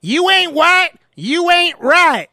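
hammed-up Southern chant, slowing down. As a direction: chant it like a family motto. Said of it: "Here's our saying".

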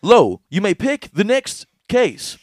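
slow, high, loud mock host accent; hammed-up quiz host; game show host. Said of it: "Korean guy on Deal or No Deal".

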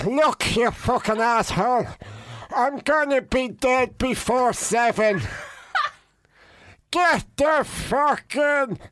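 strained, very slow mock-dying plea; dying gasps. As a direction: force the words out with your last breath. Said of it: "A comedian that thinks he's dying".

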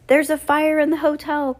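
high, loud call down a hallway. A narrator's reading. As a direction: cry it out as a warning. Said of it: "Klaus cried into the hallway".

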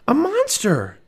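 high loud frightened cry; alarmed cry. Acted. Annie cries out to Jack, pointing at the monster. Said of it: "Annie cried".

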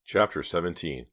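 ordinary delivery

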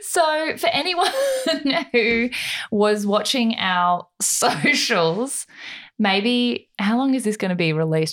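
talking through giggles; stifled giggles. Real, not acted. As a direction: fight back the laughter. Both cracking up, trying to speak.

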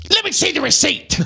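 teasing friend in a high comic voice; mock Brian voice. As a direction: squeak it out as a joking fake quote. Joking fake quote of Brian.

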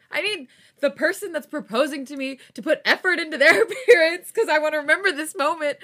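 high, loud, laughing distress; stressed rant. Real, not acted. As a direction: wail it half laughing.

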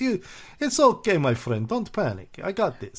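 calm him down, all charm; Stalin. Retold or imitated. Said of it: "this is Stalin's attempt to make a good impression".

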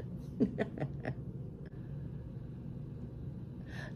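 breathy laugh. Real, not acted. Laughing at the backhanded compliment.

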